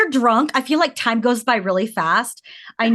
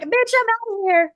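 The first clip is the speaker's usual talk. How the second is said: much higher, cry-like yelp; sassy exit line; 12 semitones higher than usual. Retold or imitated. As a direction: fling it out escaping. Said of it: "You're like".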